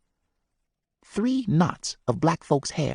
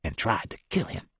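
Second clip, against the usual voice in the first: hushed confession, quiet; barely above a breath, confessing; whispered; 3 semitones lower, 6 dB quieter than usual. Acted. Man confessing a killing to boys, whispered. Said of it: "he whispered".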